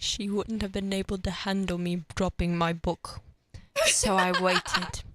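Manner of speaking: mock British royal accent; deliberate, put-on British prince voice; Prince Harry's British accent. Host's royal impression about grandmother, in British accent. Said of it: "Do you wanna hear my Prince Harry impression?".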